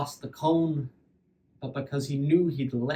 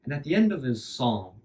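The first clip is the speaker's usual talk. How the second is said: usual speaking voice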